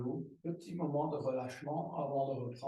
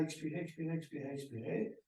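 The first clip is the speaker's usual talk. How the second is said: fading exhale cue; 7 dB quieter, faster than usual. Shown as a demonstration. Yoga teacher urging long exhale.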